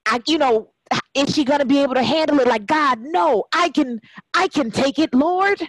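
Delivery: retold desperate prayer. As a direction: pour out the plea to God as you did back then.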